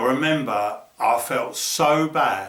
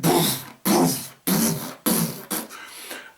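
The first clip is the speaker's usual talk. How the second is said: geese taking off; much slower than usual. Retold or imitated. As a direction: mimic geese flapping away into the distance. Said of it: "these geese went".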